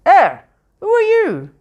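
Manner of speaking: high, slow squeaky little voice; a startled talking purse squeaking. A narrator's reading. Purse squeaks at Bilbo as it leaves the pocket, squeaky. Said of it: "it squeaked".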